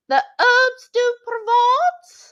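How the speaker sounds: very high, drawn-out French-cookery voice; Julia Child. Pretend. Answering a viewer's accent advice, high-pitched mock French flourish. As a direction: pitch it way up high and stretch the words. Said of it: "get the high pitch there?".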